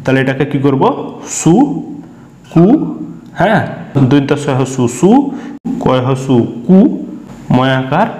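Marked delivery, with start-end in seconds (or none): 1.4-2.6 সু and কু as separate syllables with a clear pause between; teacher breaking up সু ... কু for learners. Shown as a demonstration. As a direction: sound out সু, then কু, each on its own. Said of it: "তিনটা কিন্তু একসঙ্গে উচ্চারণ করা যাবে না".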